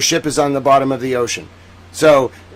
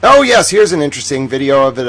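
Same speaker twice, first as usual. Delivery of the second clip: ordinary talk, nothing marked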